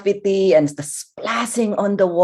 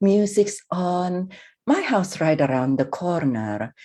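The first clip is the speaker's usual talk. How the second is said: guided relaxation; 3 semitones lower, 4 dB quieter than usual. Acted. Therapist settling a stressed listener, soft and soothing. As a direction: ease the listener into calm, gently.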